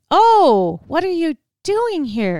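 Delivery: surprised greeting. Retold or imitated. Friends startled when the visitors drop in, surprised. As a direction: caught off guard by guests at the door.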